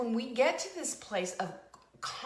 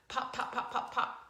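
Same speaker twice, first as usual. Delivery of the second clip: light mock pops, like popcorn bursting; popping noises; 3 semitones higher than usual. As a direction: pop each one out like popcorn going off. Imitating things popping up.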